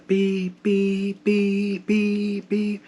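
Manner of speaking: high, even alarm beeps; imitated sensor beep. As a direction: mimic a medical monitor alarm, steady high tone. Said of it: "then I hear my sensor".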